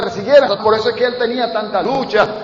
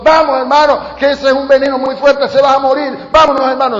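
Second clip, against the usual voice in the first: louder, higher urgent plea; desperate pleading; 7 semitones higher, 6 dB louder than usual. Retold or imitated. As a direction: beg him to hurry to the hospital. (el pastor suplicando con urgencia, más alto)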